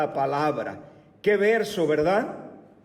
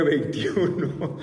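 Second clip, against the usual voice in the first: laughing at himself; slower than usual. Real, not acted. Teacher laughing at his own slip in front of the class. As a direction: chuckle at your own mistake.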